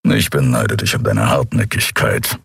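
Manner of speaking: a low, rumbling grumble from the big creature. A narrator's reading. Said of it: "brummte Kero".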